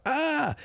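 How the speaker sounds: high startled yell of falling workers; workers' shout. Acted. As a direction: cry out as the scaffolding gives way. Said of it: "you hear them shout".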